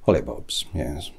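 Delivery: ordinary talk, nothing marked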